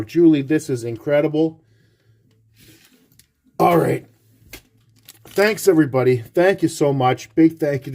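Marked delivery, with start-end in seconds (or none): none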